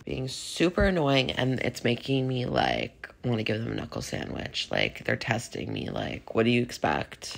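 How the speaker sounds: hoarse, worn-out voice; talking through a raspy throat. Real, not acted. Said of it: "my voice sounds a little raspy".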